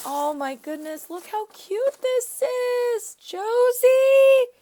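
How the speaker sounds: high, slow drawn-out squeal of delight; cooing high and loud; gushing squeal. Real, not acted. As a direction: melt over how cute it is. Gushing over a cute gift.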